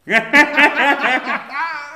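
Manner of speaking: high burst of real laughter; loud laugh. Real, not acted. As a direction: crack up loudly. Big laugh at the joke.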